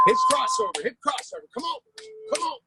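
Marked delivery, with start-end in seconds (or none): 0.1-0.8 high, loud exercise call. Real, not acted. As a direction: shout the move name to the class. Coach calls the next exercise.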